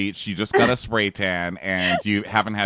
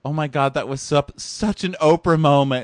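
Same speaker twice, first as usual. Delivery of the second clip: higher, louder retold gushing; mock gushing; 6 semitones higher, 6 dB louder than usual. Someone praising Danique's moment, mock gushing. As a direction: gush it like an impressed fan.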